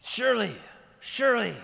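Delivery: usual speaking voice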